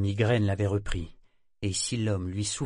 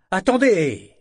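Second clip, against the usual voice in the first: loud, high, falling call to halt; almost angry exclamation; 9 semitones higher, 10 dB louder, slower than usual. A narrator's reading. Agent stopping the departing job-seeker, almost angry. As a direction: call him back with a near-angry burst. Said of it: "s'exclama-t-il presque avec colère".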